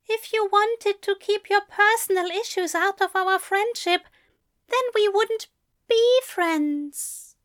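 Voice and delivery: high-pitched, upset angel voice; argue, hurt, in a high voice; high, strained reproach. Acted.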